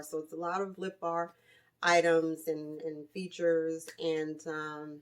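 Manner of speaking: ordinary delivery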